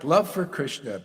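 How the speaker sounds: ordinary talk, nothing marked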